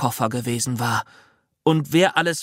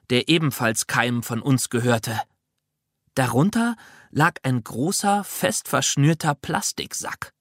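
usual speaking voice

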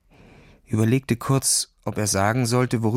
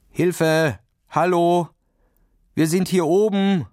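shout for help; 6 semitones higher, slower than usual. Acted. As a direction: shout into the snow for rescue. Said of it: "Schrie Ferdinand".

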